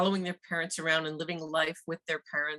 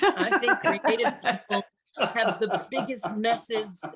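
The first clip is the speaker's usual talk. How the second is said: laughing; 4 semitones higher, 4 dB louder than usual. Real, not acted. Laughing about John's messy office.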